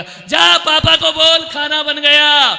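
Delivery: slow, high, loud call; mother calling out. Retold or imitated. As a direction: drag out the shout to the child. Mother's call repeated by preacher.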